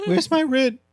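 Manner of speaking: high mock landlord demand; cold greedy landlord. Acting a landlord demanding rent.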